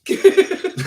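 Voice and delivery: breathless high-pitched giggle. Real, not acted. Wife giggles after admitting secret filming, high. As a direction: giggle at being caught out.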